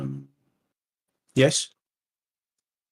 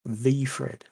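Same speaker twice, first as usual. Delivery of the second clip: "the" said as "thee"; weighty "the", softer voice; stress on THE; 8 dB quieter than usual. Shown as a demonstration. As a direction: give "the" full weight, softly. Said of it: "he deserves the definite article".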